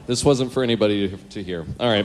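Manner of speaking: normal talking voice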